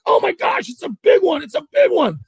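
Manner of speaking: very high, excited mock yell; frantic excitement. Partner hollers about the big fish, frantic with excitement. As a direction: imitate an angler freaking out over a catch.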